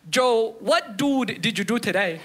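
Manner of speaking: put-on x0.6, slow proud accented call across a room; a mom calling out mangled English with confidence; mock yell of a proud immigrant mother; mom yells out proudly. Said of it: "she just yells out".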